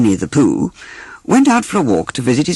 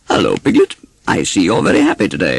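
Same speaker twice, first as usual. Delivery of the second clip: Pooh voice; 6 semitones lower than usual. Acted. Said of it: "he dropped his voice".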